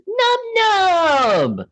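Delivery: very high squeaky cartoon voice; Nub Nub voice. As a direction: squeak it like a tiny cartoon critter. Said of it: "all he says is".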